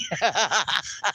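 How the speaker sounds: long, trailing cackle; real laughter. Real, not acted. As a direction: laugh until it tails off. Laughing at Norma's story.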